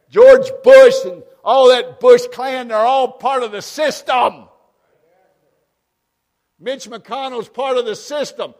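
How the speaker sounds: loud, fiery pulpit tirade; preaching at full force. Acted. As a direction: thunder it at them from the pulpit. Preacher rails at the congregation.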